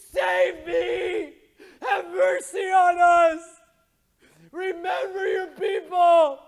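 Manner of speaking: screamed plea for rescue, loud and slow; shouted slow wailing prayer; desperate cry. Pretend. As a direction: cry out to be saved. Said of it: "I just wanted to be able to cry and scream".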